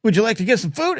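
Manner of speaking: high, sweet-talking plea for food; wheedling drunk buddies. Retold or imitated. Tipsy friends coaxing Uncle Mark into a food stop, high wheedling voice. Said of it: "Jack and I were like".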